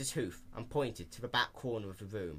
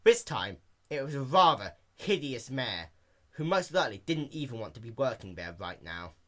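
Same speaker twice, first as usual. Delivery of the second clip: ordinary delivery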